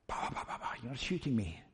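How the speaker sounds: quick low hushed aside, half breathed. Real, not acted. Preacher aside to a person with a camera, hushed and quick. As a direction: mutter it off to the side, not to the congregation. Aside to someone filming mid-sermon.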